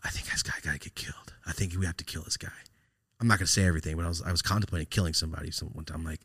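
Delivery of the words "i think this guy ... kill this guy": hushed confiding, no voice; conspiratorial whisper. Retold or imitated. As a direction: whisper it like a plot. Confiding a dark plan.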